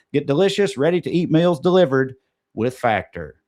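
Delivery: slow, emphatic sponsor read with spelled-out code. Acted. Podcast host pitching a promo code to listeners, punchy ad read. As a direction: sell the promo code like a radio spot.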